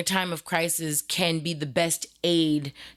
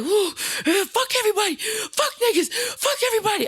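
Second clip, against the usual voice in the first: much higher, wild furious yelling; enraged shout. Retold or imitated. The upset boy yells at staff in a much higher, furious voice. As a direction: scream it like a boy out of control. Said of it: "He's like".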